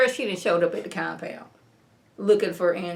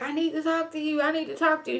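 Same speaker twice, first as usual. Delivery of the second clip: high, softer pleading, imitated; insistent plea; 7 semitones higher, 4 dB quieter, faster than usual. Woman demanding a word with Andrew, imitated insistent plea. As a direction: mimic a woman pressing to be heard.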